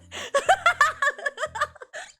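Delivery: loud squealing laughter; big high-pitched laugh. Real, not acted. Laughing at own story.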